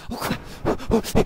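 laughing panicked yelp; being chased. Real, not acted. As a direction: shriek while laughing in fright.

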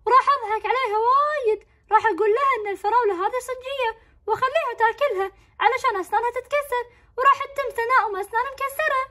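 put-on high, gleeful boy voice plotting a prank; scheming kid, delighted with himself; mischievous kid. Boy gloating over his planned prank, high and gleeful.

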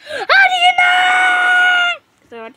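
very high, drawn-out shriek; wailing pretend outburst; hammed-up scream. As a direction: scream it, stretching the last word.

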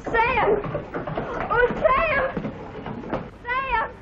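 high, eager call. Acted. Young woman hails Sam as he arrives, eager high call. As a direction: send the name out in a high, excited voice.

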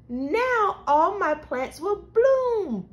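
slow, high, lilting anticipation; hopeful excitement. A narrator's reading. Girl running out to her garden, excited and hopeful. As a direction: stretch it out high with hope.

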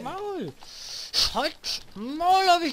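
high screamed shut up; furious shout. Real, not acted. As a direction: scream at him.

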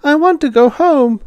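very high, loud, near-crying wail; the littlest girl begging the teacher, voice high and close to crying; tearful little girl. Acted. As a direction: whine like the littlest girl on the verge of tears. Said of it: "face of the littlest girl screwed up".

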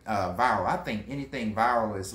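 ordinary talk

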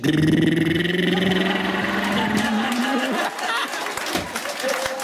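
long lip-trill motor noise, rising; monkey's noise. Acted. Monkey answering what it was doing, long revving noise. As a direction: buzz the lips in one long climbing rev. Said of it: "The monkey said".

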